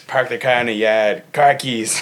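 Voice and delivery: mock Boston accent, dropped r's; hammed-up bad Boston accent; awful Boston accent. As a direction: badly imitate a Bostonian. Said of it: "awful, awful accents I've heard".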